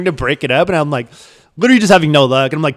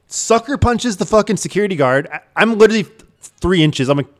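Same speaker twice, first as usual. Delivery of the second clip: ordinary talk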